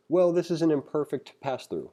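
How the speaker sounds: ordinary talk, nothing marked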